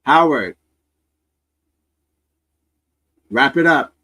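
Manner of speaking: loud mock mom shout through a door; loud, acted motherly call; mother yelling. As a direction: holler like a fed-up mother calling her son. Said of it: "His mother's banging on the door".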